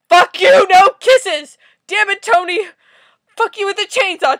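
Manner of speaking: high, very loud outburst; angry, full-volume cursing; furious yelling. Real, not acted. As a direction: yell it at the character in outrage. Furious reaction to comic character.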